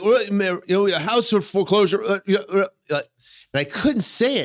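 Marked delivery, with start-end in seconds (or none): 0.0-3.0 nervous stammer. Retold or imitated. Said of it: "I couldn't get the words out".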